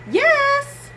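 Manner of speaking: put-on high little kid's yes; child's voice. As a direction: answer like a small boy. Child answers in story.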